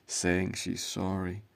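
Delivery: normal talking voice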